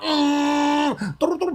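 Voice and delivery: high humming buzz imitating a vibrating phone; buzzing hum.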